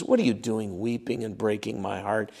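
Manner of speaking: "What are you doing" said soft, low and gentle, the voice dropping away; heartbroken. Retold or imitated. Paul gently rebuking his weeping friends, soft and heartbroken.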